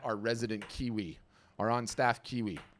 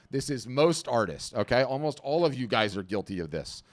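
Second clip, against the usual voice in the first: ordinary delivery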